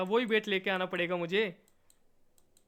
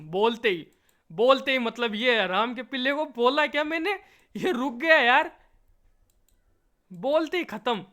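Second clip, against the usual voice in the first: loud, raised exasperation; frustrated outburst; 4 semitones higher, 6 dB louder than usual. Real, not acted. Gamer yelling that his puppy quit instantly, loud exasperation. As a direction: complain loudly that it stopped dead. Character stops at once, frustration.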